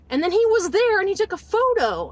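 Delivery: loud, high indignation; outraged. Real, not acted. Wife telling of husband's secret hospital photo, outraged.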